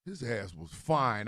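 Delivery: usual speaking voice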